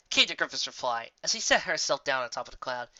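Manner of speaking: ordinary delivery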